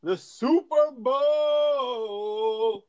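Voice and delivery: slow, drawn-out hype host voice; announcer voice. Acted. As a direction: stretch Super Bowl like a show host. Segment host intro.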